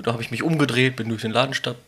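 normal talking voice

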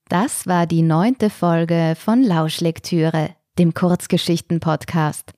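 host closing the story podcast; podcast outro. Acted. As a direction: sign off the episode warmly.

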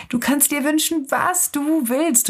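high-pitched eager exclamation; delighted little soul, voice lifted high; excited cry. A narrator's reading. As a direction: call it out joyfully, without shouting. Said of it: "rief die kleine Seele".

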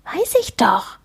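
proud whisper. Acted. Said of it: "flüstert Amelie stolz zurück".

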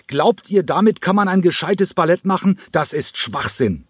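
high, furious scream. A narrator's reading. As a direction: hurl the words like the chair. Cranko schreit weiter. (Cranko schreit seine Verachtung hoch und wütend heraus)

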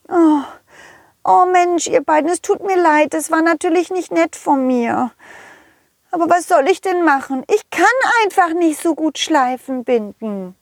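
high fairy voice, sorry; apologetic fairy. Acted. Fee entschuldigt sich. (reumütige Feenstimme)

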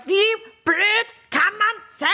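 high-pitched exasperated teacher rant; mock yelling at pupils. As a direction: play a teacher losing it with the class, punching out words. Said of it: "ich würd die Schüler anschreien".

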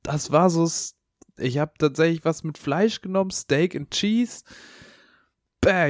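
high, disgusted complaint; grossed out about the sandwich. Real, not acted.

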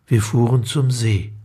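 ordinary delivery

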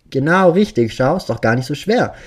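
loud, high mock-delighted praise; childlike glee. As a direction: cheer like a child clapping. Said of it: "applaudiert er wie ein kleines Kind".

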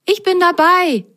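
high, loud eager yes; eager to travel. Acted. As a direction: jump at the adventure. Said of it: "sagte Rosenrot reiselustig".

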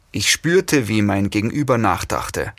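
ordinary delivery, nothing marked

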